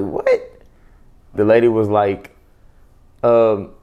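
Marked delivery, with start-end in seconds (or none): 0.0-0.6 high squeaky "what?" in disbelief; a stunned, very high little "what?"; a disbelieving falsetto "what?"; shocked squeak. Retold or imitated. Shocked at daughter's answer.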